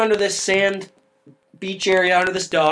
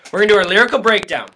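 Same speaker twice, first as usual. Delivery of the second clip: fast, louder hammed-up sponsor plug; mock ad read; 2 semitones higher, 4 dB louder than usual. Announcing a fake sponsor to the co-host, in a hammed-up ad voice. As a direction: sell it like a cheesy radio ad.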